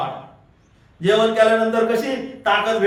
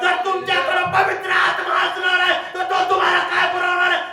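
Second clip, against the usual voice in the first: shouted call-and-response sermon; preaching at full force; 9 semitones higher than usual. Real, not acted. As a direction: lift the room with every answer.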